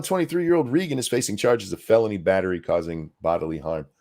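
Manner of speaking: normal talking voice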